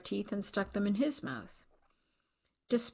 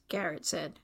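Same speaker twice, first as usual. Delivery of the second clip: ordinary delivery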